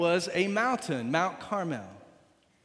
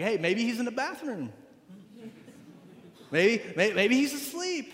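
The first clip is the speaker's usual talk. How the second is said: high, teasing impression of a heckler; mock taunting; 5 semitones higher than usual. Preacher plays Elijah teasing Baal's prophets, mock taunting. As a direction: lift the pitch and needle them. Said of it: "Elijah starts taunting these false prophets".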